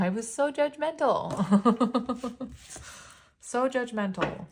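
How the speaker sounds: high, drawn-out words breaking into laughter; laughing at oneself. Real, not acted. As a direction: laugh through the confession. Laughing at her past self.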